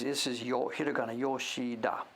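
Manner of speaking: ordinary delivery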